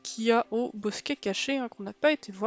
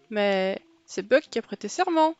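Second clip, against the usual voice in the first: ordinary delivery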